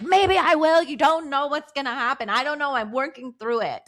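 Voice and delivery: high, teasing mock-defiant retort; playful defiance. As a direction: toss it back playfully, pitched well up. Teasing retort to husband's plea.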